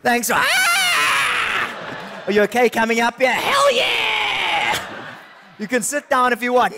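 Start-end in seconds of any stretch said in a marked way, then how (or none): 0.3-2.2 shrill squeal of a puppet wolf; high puppet shriek. Acted. 3.3-4.8 much higher cartoon wolf voice; a wild puppet wolf yelling. Puppet answers in high voice.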